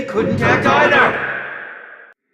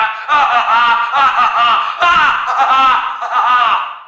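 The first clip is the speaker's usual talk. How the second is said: loud theatrical evil laugh; hammy gloating villain cackle; 2 semitones higher, 4 dB louder than usual. Acted. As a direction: cackle over her fate.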